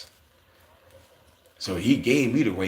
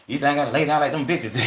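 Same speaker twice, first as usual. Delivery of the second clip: put-on higher, louder quote of the girls; excited mock quote; 6 semitones higher, 4 dB louder than usual. Girls relieved they don't have to lay down, said higher and louder. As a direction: toss it off like a proud girl bragging.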